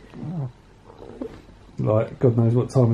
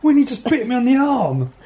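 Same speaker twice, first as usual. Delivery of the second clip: startled, high exclamation; surprised yelp; 11 semitones higher than usual. Real, not acted. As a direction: cry out, caught off guard. Cat bite, sudden cry.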